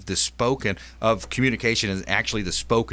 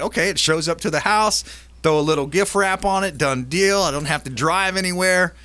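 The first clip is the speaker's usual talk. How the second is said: excited; 7 semitones higher, 4 dB louder than usual. Retold or imitated. Imagined buyer's easy shopping.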